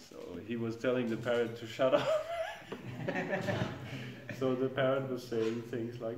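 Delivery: laughing. Real, not acted. Recalling big brother and his cursing parrot, laughing. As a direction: laugh your way through the words.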